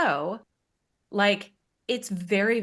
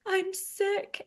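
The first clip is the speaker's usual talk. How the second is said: whiny sister; 12 semitones higher, 3 dB quieter than usual. Retold or imitated. Imitating crying sister.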